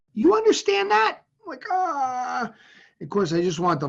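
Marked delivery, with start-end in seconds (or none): 0.1-1.2 much higher, louder sharp demand; demanding mom. Retold or imitated. Mother demands her son understand, sharp and loud. 1.7-2.5 pained yelp. Retold or imitated. Nails dig into boy's arm.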